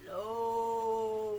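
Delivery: long, low-voiced hello; drawn-out deep greeting. Shown as a demonstration. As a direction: get your low voice out. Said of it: "as low as we can".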